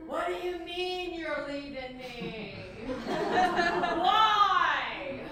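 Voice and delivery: theatrical abandoned lover, indignant then pleading; hammed-up stage drama. Anna performing for the party, melodramatic jilted lover. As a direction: deliver each line big, with long stagey pauses.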